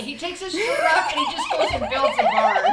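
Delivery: high, loud, laughing fantasy. Real, not acted. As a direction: squeal it out through laughter.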